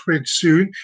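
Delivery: normal talking voice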